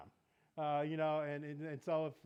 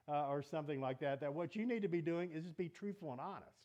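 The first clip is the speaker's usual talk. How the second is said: ordinary talk, nothing marked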